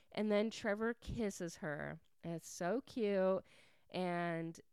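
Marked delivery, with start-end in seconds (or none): none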